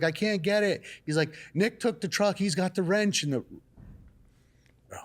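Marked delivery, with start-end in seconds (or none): 0.0-3.4 high, loud frantic cry; retold panicked old plumber. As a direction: voice him in a panic. Plumber panicking over gas leak.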